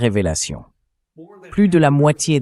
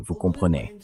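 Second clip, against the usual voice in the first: mock sympathy; 5 semitones lower, 7 dB quieter than usual. Real, not acted. Mocking the clip's excuse.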